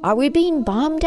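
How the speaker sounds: high, rising alarmed question; mildly alarmed boy. A narrator's reading. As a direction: ask it like a worried child. Frightened boy asks about bombs.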